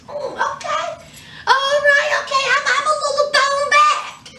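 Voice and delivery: hammed-up taken-aback reaction; mock surprise. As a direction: act startled by the accent. Man reacting to Russian accent.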